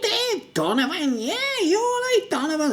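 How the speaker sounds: high laughing squeaky mock voice; mocking weird guy. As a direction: squeal the name like a pest. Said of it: "this man is going".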